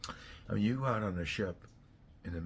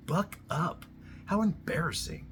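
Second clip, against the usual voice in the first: higher, mocking jab; scornful taunt; 8 semitones higher than usual. Real, not acted. Taunting men who whine, higher scornful jab. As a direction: needle them with contempt.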